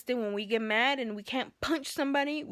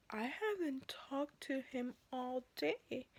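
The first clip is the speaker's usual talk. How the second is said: slow, much softer, mumbled denial; evasive mumble; 3 semitones higher, 11 dB quieter, slower than usual. Retold or imitated. Imitating the dispatcher's soft slow denial. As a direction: mumble the lie quietly. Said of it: "And she goes".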